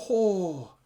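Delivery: loud, high falling "oh"; pained, awed "oh". Real, not acted. Recalling the wrestler's kicks, loud awed groan. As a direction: wince out loud at a brutal hit.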